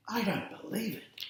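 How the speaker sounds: a fan gasping in disbelief; stunned fan. A narrator's reading. Fan in the crowd reacting to the goal, high and hushed disbelief. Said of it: "says someone else".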